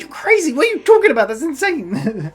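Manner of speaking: high-pitched incredulous outburst; mock exasperation, dragging out the last words; Dennis impression. Dennis reacting to a wild suggestion, imitated disbelief.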